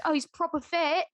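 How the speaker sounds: high-pitched mock Cockney; exaggerated Cockney reality-show quote; Cockney accent. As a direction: imitate a Love Island Cockney. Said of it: "like, Cockney and gross".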